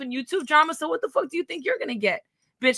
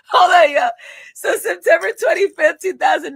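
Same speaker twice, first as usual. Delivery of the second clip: laughing loud; 4 semitones higher, 7 dB louder than usual. Real, not acted.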